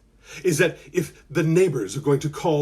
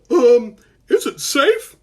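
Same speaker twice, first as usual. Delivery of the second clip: put-on timid child; 7 semitones higher, 6 dB louder, slower than usual. Cooper peeking out from blanket.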